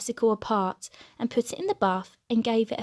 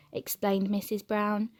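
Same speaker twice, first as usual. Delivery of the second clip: ordinary delivery, nothing marked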